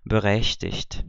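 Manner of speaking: Berechtigt slowly, with G matching the CH; two ch sounds. Shown as a demonstration. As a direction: Berechtigt as a slow model, ending -icht. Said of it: "um representado pelo C H e um pelo G".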